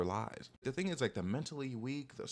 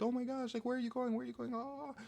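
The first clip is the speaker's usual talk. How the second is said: much higher, mock tearful pleading; mock sobbing plea; 14 semitones higher than usual. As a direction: fake a desperate sob-plea up high. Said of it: "like, crying, like, sobbing".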